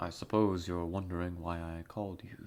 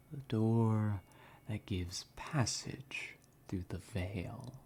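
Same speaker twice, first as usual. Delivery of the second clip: mysterious intro narration; 3 dB quieter than usual. Acted. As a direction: draw the listener in through the mist, half whispering. Dramatic whispered intro narration.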